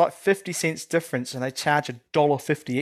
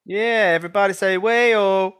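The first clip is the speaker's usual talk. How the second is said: hyped crowd warm up; 3 semitones higher, 3 dB louder than usual. Acted. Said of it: "let me hear you say".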